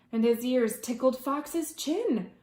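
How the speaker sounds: usual speaking voice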